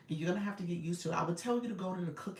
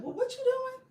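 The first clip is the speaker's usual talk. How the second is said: high, loud mocking voice of scornful older kids; squealing teenage mockery; 4 dB louder than usual. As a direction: mimic teens ridiculing mom's TikTok with laughing disdain. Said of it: "they was like".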